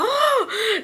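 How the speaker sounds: high, drawn-out oh; amazed high-pitched coo; imitated delight. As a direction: echo the impressed squeal. Imitating the other girls.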